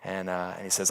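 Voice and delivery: ordinary talk, nothing marked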